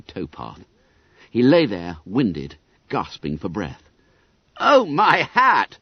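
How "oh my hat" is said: slow, loud, high dismayed exclamation; dismayed cry. Acted. Harry sees the fallen secretary, slow loud cry. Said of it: "cried Harry Wharton".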